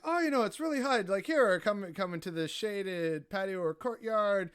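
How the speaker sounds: high, loud, pressing welcome; urgent hospitable host. Retold or imitated. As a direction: beckon him in out of the heat. Locals urging him into shade.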